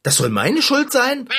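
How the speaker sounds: high, incredulous protest; indignant disbelief. Acted. Mechanic blamed by cat for toothache, indignantly incredulous. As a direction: pitch shoots up in affront.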